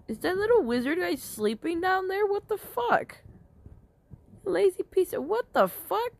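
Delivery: indignant at the lazy wizard; outraged disbelief. Real, not acted.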